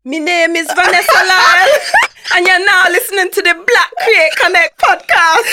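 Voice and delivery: loud, high Jamaican accent broken by laughter. Shown as a demonstration. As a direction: belt the Jamaican intro while cracking up. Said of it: "I was gonna request Jamaican".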